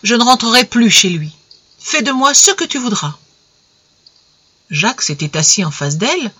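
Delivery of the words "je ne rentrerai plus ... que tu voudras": avec fermeté. A narrator's reading. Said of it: "Elle ajouta avec fermeté".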